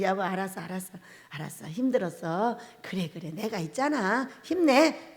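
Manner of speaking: soft, low reassurance; husband quietly reassuring his nagging wife, soft; soothing husband. Shown as a demonstration. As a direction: hush her worries gently. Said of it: "이렇게 해주면 바로".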